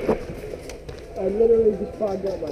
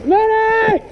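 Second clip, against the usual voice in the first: man down shout; 10 semitones higher, 6 dB louder than usual. Real, not acted. Shot player calling for medic.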